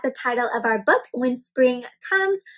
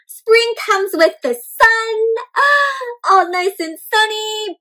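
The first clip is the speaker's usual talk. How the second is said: higher and louder with a gasp of delight; 8 semitones higher, 6 dB louder, slower than usual. A narrator's reading. Teacher reading sunny page to children, delighted gasp. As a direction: open up bright with wonder at the sun.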